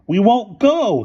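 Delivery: high, loud stormy shout; furious outburst. A narrator's reading. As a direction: storm at them. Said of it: "stormed Boots".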